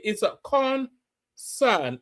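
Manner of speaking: very slow, overdone "concern"; slow correct pronunciation. Shown as a demonstration. Vlogger teaching how to say concern. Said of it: "Concern i".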